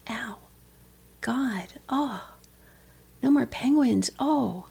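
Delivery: soft, breathy lament to God; hushed, acted grief; pained prayer. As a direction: quietly groan the hurt out in prayer. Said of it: "if you bring that pain and you go".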